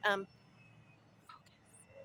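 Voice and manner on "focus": barely audible whisper; tiny whispered aside; whispered self-reminder. Real, not acted. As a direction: whisper it to yourself to regain focus. Whispered aside to refocus herself.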